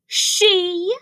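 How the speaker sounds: high-pitched startled cry; cried out. A narrator's reading. Rabbit hearing that Ribbit is a she, crying out. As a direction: cry out in disbelief. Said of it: "cried Rabbit".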